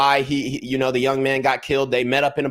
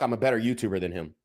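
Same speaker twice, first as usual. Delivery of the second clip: quick, soft muttered jab under the breath; muttered aside; 5 semitones lower, 8 dB quieter, faster than usual. Real, not acted. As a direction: slip it in quietly, a little cheeky.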